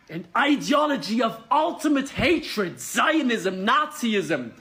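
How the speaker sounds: indignant political tirade; impassioned rant. Real, not acted. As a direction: hit each damning word with rising outrage. Impassioned rant against Israel.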